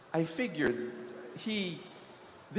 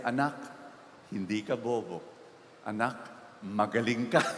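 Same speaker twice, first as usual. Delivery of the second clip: tender mother; 8 semitones lower than usual. Retold or imitated. Mother crying over report card.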